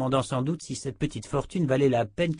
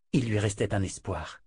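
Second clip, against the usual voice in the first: ordinary delivery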